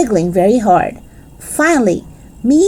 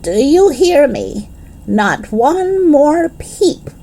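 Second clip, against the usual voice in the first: slow, higher, stern grouchy warning; grouchy grandma; 6 semitones higher, slower than usual. Acted. Nana scolds the girls at bedtime, grouchy and slow. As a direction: drag it out like a cranky grandmother. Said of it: "very grouchy".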